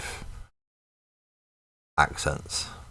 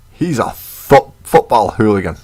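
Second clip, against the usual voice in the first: raised, loud mock labelling; mock accusation; 4 semitones higher, 6 dB louder than usual. Others judge speaker by his accent, mock accusation. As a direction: call someone out in a raised voice. Mock voice of people judging him.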